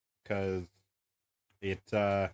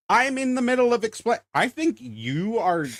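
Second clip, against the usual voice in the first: raised, high-pitched objection; exasperated protest; 12 semitones higher, 5 dB louder than usual. Real, not acted. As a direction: protest loudly at being talked over. Protesting being talked over mid-explanation.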